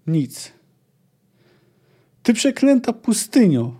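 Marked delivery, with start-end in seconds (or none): 2.2-3.7 higher, louder cry at the desert; shouting. A narrator's reading. Ciri cursing the empty desert, crying out high and loud. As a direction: hurl it out, fists shaking. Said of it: "krzyknęła, potrząsając pięściami".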